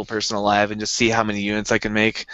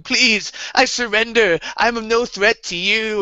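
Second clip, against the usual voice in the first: high, slow pleading whine; high cringing plea; mock begging villain; 10 semitones higher, slower than usual. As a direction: grovel on the ground. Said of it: "he's on the ground going".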